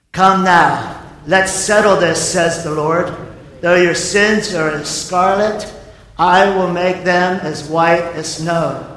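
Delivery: preacher reading God's promise to the congregation, slow and solemn; scripture reading. Real, not acted. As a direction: read the verse slowly with weight.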